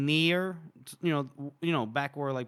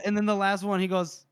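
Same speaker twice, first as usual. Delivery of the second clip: usual speaking voice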